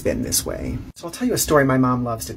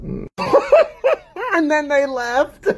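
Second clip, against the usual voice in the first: laughing; 14 semitones higher, much slower than usual. Real, not acted. Laughing at his own story.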